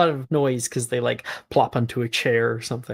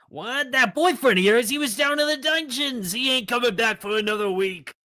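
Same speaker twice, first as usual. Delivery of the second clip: brassy gum smacking floozy; 11 semitones higher than usual. Acted. Skull character keeps flirting.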